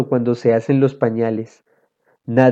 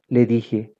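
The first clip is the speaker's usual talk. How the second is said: plain reading voice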